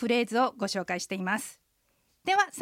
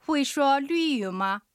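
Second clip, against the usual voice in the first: pronunciation practice; 3 semitones higher, much slower than usual. Shown as a demonstration. Host practises today's sentence with listeners, slow and careful. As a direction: draw out each syllable for learners to repeat. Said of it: "もう一度おさらいしてみましょ".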